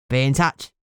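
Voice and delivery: low Cockney sign-off; thick Cockney. A narrator's reading. Ghoul informant promising as he leaves, thick Cockney. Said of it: "The Cockney accent came out thick and broad".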